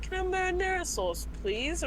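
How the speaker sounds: quick, high, soft British request; hurried imitation of a British customer; mock British accent. Teller imitating the marinara order, British accent. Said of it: "four British people that day".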